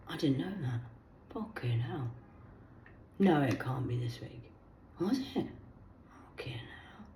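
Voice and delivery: hushed, breathless whisper of disbelief; shocked whisper. Real, not acted. Reacting to the news for her viewers, stunned whisper. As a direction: whisper it as if the news just sank in.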